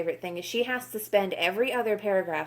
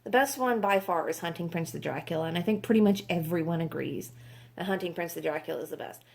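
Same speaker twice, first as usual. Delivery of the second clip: ordinary talk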